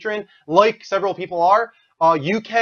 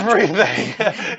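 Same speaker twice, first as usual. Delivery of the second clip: slow mimicking echo through laughter; Gary Oldman; 3 semitones higher, much slower than usual. Host mimicking the caller's answer, laughing mock-dramatic voice. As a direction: draw the word out, half laughing. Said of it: "He's like, uh, Gary Oldman".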